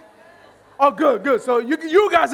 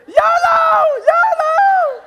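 piercingly high, loud imitation whoop; mock the girls with a long high squeal; whooping party girls; 14 semitones higher, 6 dB louder, much slower than usual. Comedian mimics yolo girls in video, whooping squeal. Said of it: "This is the yolo girls right over here".

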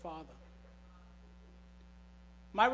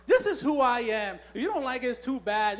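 defiant motto; 6 dB louder than usual. Retold or imitated. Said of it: "that's the saying that we all have".

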